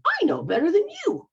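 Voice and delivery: high, loud, lecturing voice; mock bossy elder. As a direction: talk down like a know-it-all. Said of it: "you were taught".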